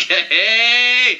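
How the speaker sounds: long mock-delighted call; acted goofy greeting. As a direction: stretch it out high, hamming up the character's look. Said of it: "He looks like he is just".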